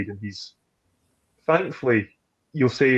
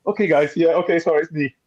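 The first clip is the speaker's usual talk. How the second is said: sheepish apology; 6 semitones higher, 3 dB louder than usual. Retold or imitated. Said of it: "I was like".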